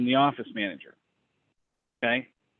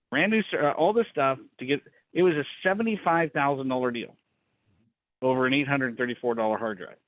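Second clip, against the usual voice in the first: ordinary talk, nothing marked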